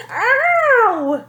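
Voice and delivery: high, loud pained shriek; mother hit in the eye, shrieking. A narrator's reading. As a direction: yelp in pain. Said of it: "shrieked Mother".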